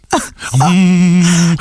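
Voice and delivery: low buzzing hum; resonant hum. Acted. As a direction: hum so the lips vibrate. Humming into his hand.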